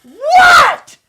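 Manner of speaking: very high, very loud shout; shouted reply. Retold or imitated. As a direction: bark it back. Heather yells back.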